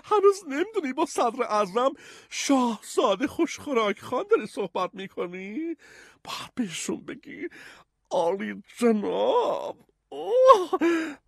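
put-on very high, hooting old dame; high-pitched grande dame, stretching the title; laughing old lady. A narrator's reading. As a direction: scold in a piping, amused old-lady voice. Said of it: "بانوی پیر باز خندید و گفت".

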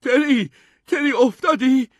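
put-on high, loud boy's shout. A narrator's reading. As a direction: yell down to your brother in alarm. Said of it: "داد زد".

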